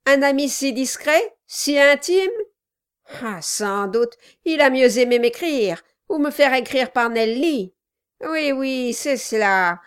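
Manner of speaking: loud, mock-offended dwarf; sarcastic dwarf. A narrator's reading. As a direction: feign hurt friendship, sneering. Dwarf feigning hurt friendship.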